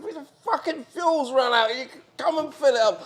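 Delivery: retold complaint. To the boat owner, half-hammed angry complaint. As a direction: tell him the fuel ran out, exasperated. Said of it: "I said".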